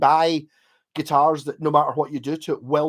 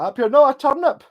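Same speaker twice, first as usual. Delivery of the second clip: put-on high, loud kid's voice; wee boy caller; 10 semitones higher, 7 dB louder than usual. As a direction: yell it like a cheeky wee boy on the phone. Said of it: "the wee boy's like".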